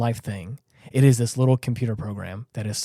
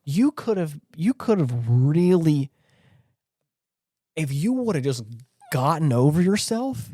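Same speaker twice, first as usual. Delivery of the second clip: impassioned reproach; 4 semitones higher than usual. Pretend. Reproaching Am directly.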